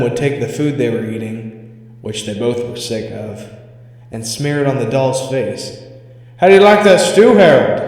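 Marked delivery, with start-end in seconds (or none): 6.4-7.8 loud, high jeering taunt; mocking taunt. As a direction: rub it in his face, louder. Mocking the doll with food.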